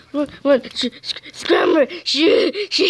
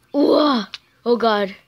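normal talking voice

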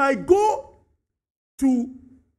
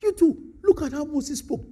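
ordinary talk, nothing marked

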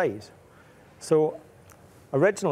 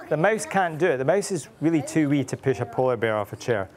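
ordinary delivery, nothing marked

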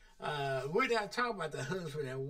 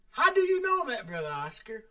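doubting caller; 7 semitones higher, 6 dB louder, faster than usual. Retold or imitated. Listener challenging the preacher.

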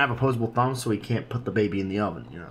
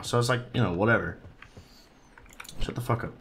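normal talking voice

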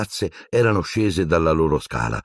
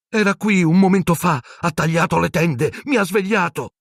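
high, urgent frightened voice; frightened boy; 13 semitones higher than usual. Acted. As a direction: insist in panic. Ron terrified after attack.

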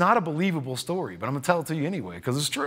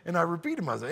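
ordinary talk, nothing marked

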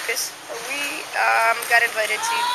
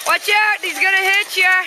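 warning shout; 6 semitones higher, 6 dB louder than usual. Real, not acted. Warning during play.

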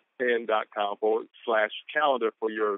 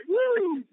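excited woo. Real, not acted. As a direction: let out a joyful woo. Fired-up motivational call.

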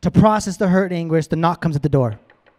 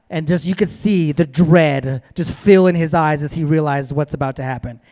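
ordinary talk

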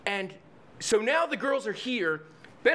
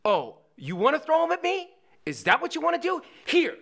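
higher, mock-indignant dare; raised voice, pushing for a fight; goading challenge; 6 semitones higher, 3 dB louder than usual. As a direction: act out a bully daring someone. Retold bully daring the kid.

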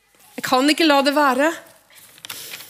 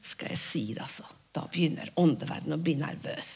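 much lower, much softer aside; confiding hush; 6 semitones lower, 10 dB quieter, faster than usual. Acted. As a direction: lean in and let them in on a secret. Hemmelighet sagt lavt. (lavmælt, fortrolig hvisking)

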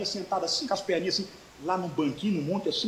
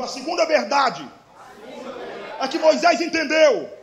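raised, higher voice leading the congregation; loud call to repeat; 6 semitones higher, 8 dB louder, slower than usual. Shown as a demonstration. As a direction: call it out for the crowd to echo. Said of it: "Grita assim comigo bem alto". (chamado em voz alta para repetir)

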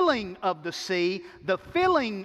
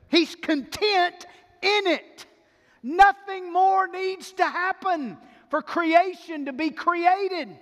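impassioned preaching; 7 semitones higher, 3 dB louder than usual. Real, not acted. Preacher telling church God is satisfied, impassioned proclamation. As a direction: declare each phrase with ringing force.

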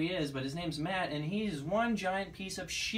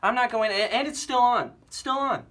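raised, heated voice; defiant refusal; 3 semitones higher, 5 dB louder than usual. Acted. Fired DJ refusing to leave the air, defiant and heated. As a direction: dig in loudly and defiantly.

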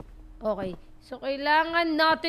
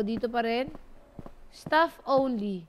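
ordinary delivery

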